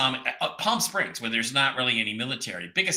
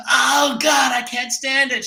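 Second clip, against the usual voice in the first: high, loud hammed-up wail; mock pain; 12 semitones higher, 6 dB louder, slower than usual. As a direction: play a joke patient yelping when touched. Joke patient howls in pain.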